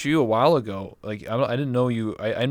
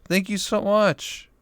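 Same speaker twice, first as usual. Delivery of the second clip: much higher, loud, squealing thanks; real excited gratitude; 12 semitones higher, 6 dB louder than usual. Real, not acted. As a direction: burst out thrilled at a big gift. Reacting to ten gifted subs.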